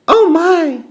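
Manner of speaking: put-on very high, startled little-girl voice; startled gasp. A narrator's reading. Goldilocks wakes to three bears looking down, gasping. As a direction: gasp it out in sudden alarm. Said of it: "she gasped".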